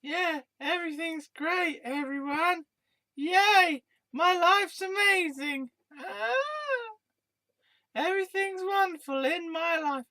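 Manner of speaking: squeaky high, forced happy; chirpy sarcastic sing-song; fake cheerful voice. Sarcastic fake-happy opening skit.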